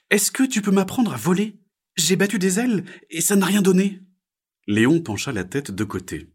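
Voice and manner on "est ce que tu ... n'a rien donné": very high, louder frog voice; little frog. Acted. Little frog asks to learn flying, high character voice. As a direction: pipe up high as a tiny frog.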